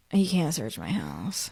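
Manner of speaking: quiet, slow, crushed retelling; understated devastation, low energy and slow; devastated. Retold or imitated. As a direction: imitate someone softly stunned and devastated. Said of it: "he was so devastated".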